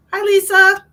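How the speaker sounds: bright, loud, high hello; excited greeting. Real, not acted. Streamer greeting a viewer, loud and high. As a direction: call out a happy hello.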